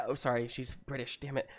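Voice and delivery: usual speaking voice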